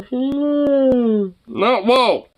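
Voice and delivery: high, loud no whoa; startled high shout. Real, not acted. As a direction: recoil from the licking dog. Dog licks at face.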